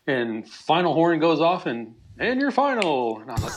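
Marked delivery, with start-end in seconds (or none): 2.2-3.2 high, slow announcer call; imitated announcer. Announcer calling the game over too soon, imitated high, slow call. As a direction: draw it out like a mock arena announcement.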